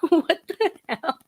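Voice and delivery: slow, high disbelief; what the hell, stretched out; incredulous. Real, not acted. Incredulous reaction.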